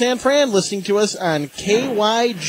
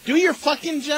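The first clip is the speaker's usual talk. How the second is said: angry; 7 semitones higher, 4 dB quieter than usual. Acted. Host berating producer off air.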